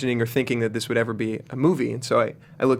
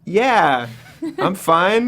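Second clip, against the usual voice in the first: higher, louder put-on voice of a castmate; imitating Paul; 7 semitones higher, 4 dB louder, much slower than usual. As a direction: imitate a fellow actor's reply. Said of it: "That was a great impression of Paul".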